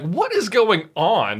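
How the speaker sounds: mock-baffled outcry; baffled reaction. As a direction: play up the bewilderment, stretching the words. Acting out bafflement at TV scene.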